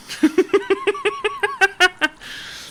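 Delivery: high-pitched amused giggle. Real, not acted. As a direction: giggle high after the joke. Laughing at own mockery.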